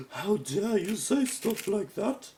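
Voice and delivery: gasping, loud, high mock-British outrage; gasp, then slowly scold in a bad British accent; terrible British accent. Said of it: "they have a British accent".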